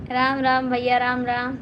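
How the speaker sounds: ordinary delivery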